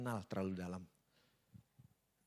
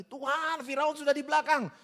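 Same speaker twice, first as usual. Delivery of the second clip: panicked shout; 14 semitones higher, 9 dB louder than usual. Acted. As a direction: yell out in alarm as Moses. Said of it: "Musa teriak-teriak lagi".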